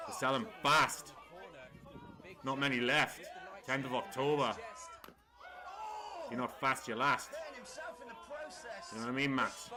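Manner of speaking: hyped-up promo plug; ad read. Shown as a demonstration. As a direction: sell the tickets like a radio ad. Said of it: "What a time to plug it".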